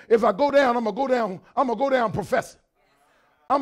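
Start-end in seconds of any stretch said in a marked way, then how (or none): none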